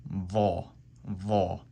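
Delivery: va with heavy buzzing ZH. Shown as a demonstration. Teacher contrasting va with ba. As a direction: buzz out a heavy va. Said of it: "heavy Z H sound".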